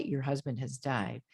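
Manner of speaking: low, quiet, final; gentle bad-news voice. Shown as a demonstration. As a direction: break it softly and clearly. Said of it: "that's how you speak when you're giving the bad news".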